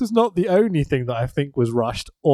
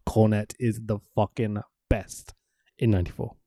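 ordinary talk, nothing marked

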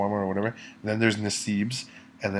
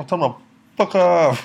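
defiant; 8 semitones higher, 8 dB louder than usual. Real, not acted. Mock threat for friend.

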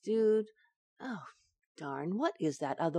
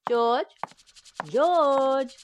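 calling George from another room, louder the second time; 7 semitones higher, 6 dB louder than usual. Acted. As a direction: raise the voice to find him. Wife calling husband through house.

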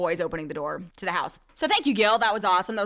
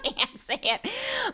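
laughing; 14 semitones higher, much slower than usual. Real, not acted. Giving up on a word.

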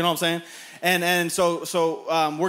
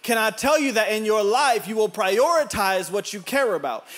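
normal talking voice

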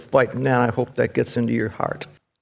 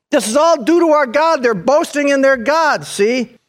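high, loud, fervent preaching; preaching at full force; 10 semitones higher, 4 dB louder than usual. Acted. Preacher building to a peak.

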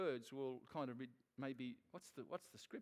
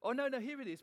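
relieved find; 9 semitones higher, 8 dB louder, faster than usual. Real, not acted. Finds the verse after searching.

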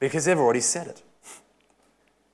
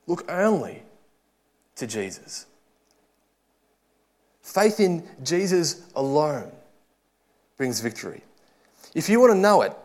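raised, ringing preacher's appeal; preach; 5 semitones higher than usual. Acted. Preacher exhorting the congregation, fervent and raised. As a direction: urge the congregation toward Jesus with full conviction.

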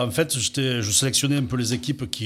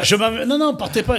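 much higher, louder pleading; mock panicked plea; 11 semitones higher, 6 dB louder than usual. The coach retelling his exchange with the bodyguard, a mock panicked plea. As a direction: beg them not to leave, in mock panic. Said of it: "J'ai dit".

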